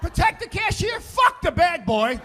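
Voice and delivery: loud, high, slowing on the last words; comic shout to the crowd; punchline shout. Real, not acted. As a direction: belt out the punchline.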